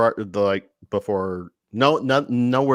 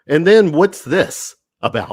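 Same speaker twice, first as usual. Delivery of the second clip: loud, higher teasing question; 7 semitones higher, 10 dB louder than usual. Real, not acted. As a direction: call him out with a grin. Teasing host about Greg.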